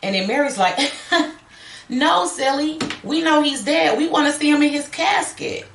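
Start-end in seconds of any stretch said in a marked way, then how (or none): 0.8-1.3 high, brief put-on giggle; dismissive little acted laugh; short mock laugh. Playing Mary laughing at Judy's question, mock amusement. Acting Mary's laugh before her reply. 1.9-5.7 high-pitched, playful mock child voice; taunting little girl. Playing Mary to the grieving mother, blithely taunting.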